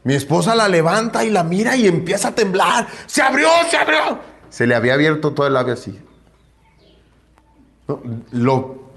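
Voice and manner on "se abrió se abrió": high panicked cry, much higher; panicked shout. Retold or imitated. Wife panicking over bleeding daughter, high frightened shout. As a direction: scream it as a terrified mother.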